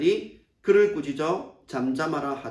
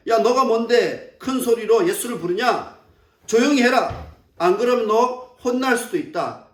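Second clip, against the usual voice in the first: raised, higher mock scolding; retold threatening scolding; 4 semitones higher than usual. Preacher voices the crowd silencing the blind man, scolding and threatening. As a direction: imitate someone angrily ordering silence. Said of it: "꾸짖어 잠잠하라 하는 건".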